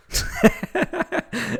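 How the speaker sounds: real high-pitched burst of laughter; helpless giggling fit; high giggle fit. Real, not acted. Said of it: "it's so hard to say without laughing".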